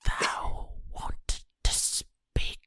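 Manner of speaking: slow, eerie unvoiced whisper; creepy devil ASMR whisper. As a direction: whisper it like the devil tempting her. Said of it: "With, like, slight reverb".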